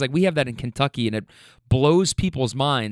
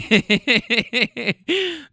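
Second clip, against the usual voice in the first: high, sustained giggling; real laughter; 8 semitones higher than usual. Real, not acted. Teller amused by the door finally shutting, real giggling laugh. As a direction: burst out laughing at the memory. Laughs after retelling the cheer.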